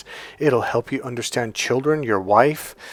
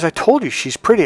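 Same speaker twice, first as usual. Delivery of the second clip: exasperated client; 8 semitones higher, 5 dB louder than usual. Retold or imitated. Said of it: "And he goes".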